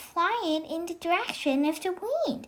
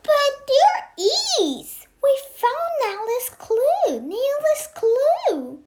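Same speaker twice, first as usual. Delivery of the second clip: higher, louder, slow and emphatic; excited insistence; 9 semitones higher, 3 dB louder, slower than usual. Acted. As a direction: insist eagerly, almost shouting. Child insists against the teacher.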